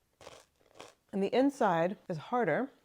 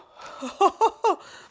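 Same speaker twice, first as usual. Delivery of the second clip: giggles at the smell; sudden high laugh; high burst of laughter; 12 semitones higher, 9 dB louder than usual. Real, not acted. Cheese smells surprising, she laughs.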